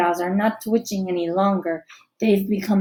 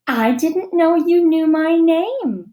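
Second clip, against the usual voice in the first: higher, slow, louder delight; coy and pleased; flattered; 7 semitones higher, 5 dB louder, slower than usual. A narrator's reading. Girl tells a boy she's flattered, slow and higher. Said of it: "she says, tilting her head".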